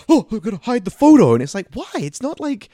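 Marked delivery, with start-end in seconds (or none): 0.1-1.3 higher, louder guilty voice; sneaky mock voice. Mocking Alan Grant hiding the photo, higher and louder. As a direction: play it sheepish and sneaky.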